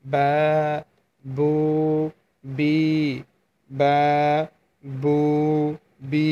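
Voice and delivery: বা, বু, বি each from the open mouth space; vowel length demo. Shown as a demonstration. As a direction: separate drill syllables বা বু বি. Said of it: "মাদ উচ্চারিত হয়".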